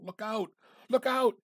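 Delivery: high, very loud shout of alarm; panicked shout. A narrator's reading. Sick man crying out at the crash, panicked shout. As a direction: scream it out as the wreck hits. Quoted cry in story.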